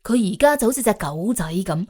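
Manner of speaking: usual speaking voice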